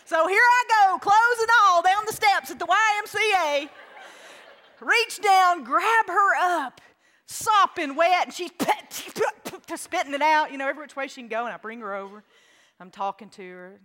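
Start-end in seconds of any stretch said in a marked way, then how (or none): 0.0-11.4 higher, louder panicked retelling; having a heart attack. Real, not acted. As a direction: tell it in a rush of panic. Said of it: "I about had a heart attack right then".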